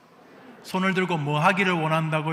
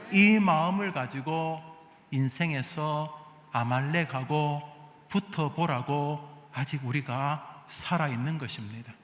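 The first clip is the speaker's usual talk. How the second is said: ordinary delivery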